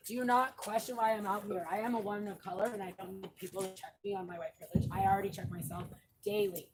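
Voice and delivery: ordinary talk, nothing marked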